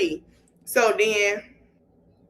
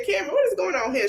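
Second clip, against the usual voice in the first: fast, high mock alarm; startled; 8 semitones higher, much faster than usual. Retelling a friend's reaction to the walkout, mock startled.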